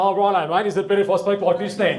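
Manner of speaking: mock a goofy voice for the coworker; stupid voice. [name] putting on a stupid voice for Troy. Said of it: "I've gotta put this stupid voice on".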